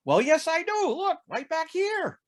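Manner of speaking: smug, pleased with himself; Texan bragging. Acted. Texan shows off his bed.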